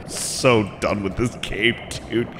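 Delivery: soft, spent half-whisper; drained relief. Real, not acted. Player so done with this game, drained soft voice. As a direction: let it out softly, worn out.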